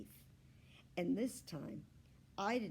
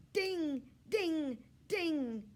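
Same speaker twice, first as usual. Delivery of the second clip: high, bright ringing dings; bell sound; 9 semitones higher, 8 dB louder, much slower than usual. A narrator's reading. The wheel's bell finally ringing. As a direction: ring it out like a little bell.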